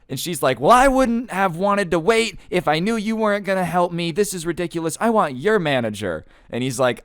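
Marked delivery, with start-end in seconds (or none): 0.6-6.2 high, loud, outraged complainer; raised-pitch demand for the manager; indignant customer. Retold or imitated. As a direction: imitate an entitled customer kicking off. Acted angry customer demanding manager.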